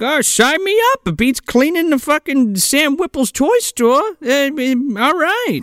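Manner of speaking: put-on high, chirpy old-man Santa; bubbly rambling Santa; cheery Santa. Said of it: "he's like".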